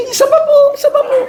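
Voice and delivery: put-on a child laughingly asking for another smack; cheeky child. Child begging for more spanking.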